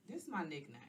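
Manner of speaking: usual speaking voice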